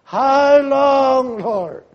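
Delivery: high, loud cry of how long; shouted lament that falls away on Lord; anguished cry. Acted. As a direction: call out in anguish. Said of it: "they're crying to God".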